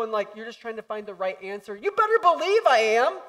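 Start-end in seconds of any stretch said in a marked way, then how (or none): 1.7-3.2 high loud comeback; defiant retort. Acted. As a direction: fire it back proudly.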